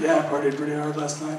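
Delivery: low, soft, quick mumble; tired mumble. Retold or imitated. Hungover stranger admits to partying, low mumble. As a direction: rush it out under your breath. Tired partier explains himself.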